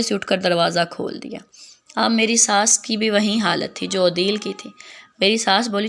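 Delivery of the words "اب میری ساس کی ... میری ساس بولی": plain reading voice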